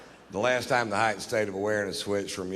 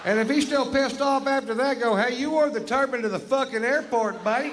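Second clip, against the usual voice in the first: ranting yell; 12 semitones higher, 4 dB louder than usual. Acted. Comic rant, yelling.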